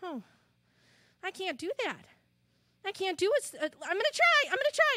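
Hopper's unsure voice. Acted. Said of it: "And Hopper goes".

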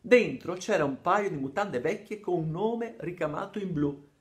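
ordinary delivery

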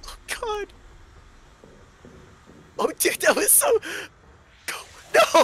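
very high, loud panicked shrieks. Real, not acted. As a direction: shriek in terror. Panic in combat.

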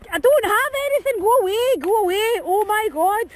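high, loud protest; fending off the dogs. Real, not acted. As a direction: shoo the dogs off, exasperated. Dogs crowding her.

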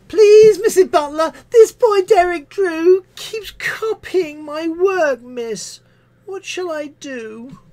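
put-on high, slow childish whine; whiny pupil. Pupil telling tales to Missus Butler, high whine. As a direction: plead like a tattling schoolchild.

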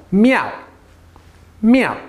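"Meow" high, then dropping fast; abrupt high falling "Meow"; sharp fourth tone. Shown as a demonstration. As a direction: throw out "Meow" with a sharp fall. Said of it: "We got fourth tone though".

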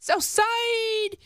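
high, sing-song cheer; excited high call. Real, not acted. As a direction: call it out like a proud fan. Echoing the hometown shout-out.

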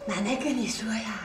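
put-on secretive whisper to a child. Grandma confiding to little granddaughter in a whisper.

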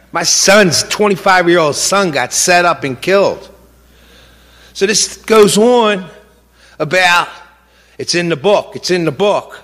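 a grieving father's fury spilling over; outraged. Real, not acted.

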